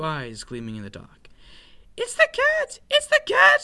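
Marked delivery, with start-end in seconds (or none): 2.0-3.6 very high, loud mouse squeal; panicked cry. A narrator's reading. Frightened little mouse warns his sister of the cat, panicked cry. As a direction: squeal the alarm at the top of your voice. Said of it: "he cried".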